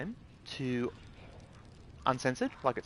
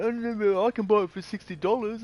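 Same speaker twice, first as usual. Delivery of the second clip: higher mocking voice; mocking gamer; 6 semitones higher than usual. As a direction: parody a smug buyer, pitched up. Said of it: "people are going".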